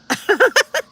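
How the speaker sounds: short burst of high laughter; high giggle. Real, not acted. Host amused before saying call her doctor, high quick laugh. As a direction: crack up briefly, high.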